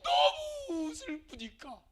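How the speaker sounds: slow tearful voice; voiced sorrow. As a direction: let it sound heartbroken and slow.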